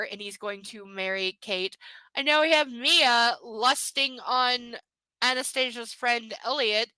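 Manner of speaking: normal talking voice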